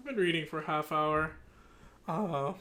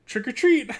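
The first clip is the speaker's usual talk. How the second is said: loud, higher-pitched holler; trick-or-treat call; 7 semitones higher, 10 dB louder than usual. Acted. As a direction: call it out like a kid at a door. Voices trick-or-treaters in the story.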